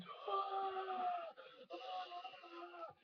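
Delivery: two high-pitched imitated screams; startled screams. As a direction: mimic a friend screaming in fright. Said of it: "And he goes".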